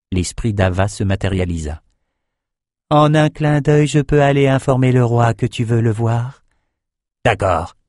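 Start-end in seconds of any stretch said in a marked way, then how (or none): 2.9-6.4 high airy spirit voice; a ghostly helper offering to fly off. Acted. Spirit of Ava offering to fetch the king, high otherworldly voice.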